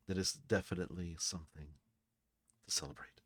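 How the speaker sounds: soft, low, fading nearly to a whisper; hushed reply. Acted. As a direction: answer quietly, trailing into a whisper. Bartender confides across the bar.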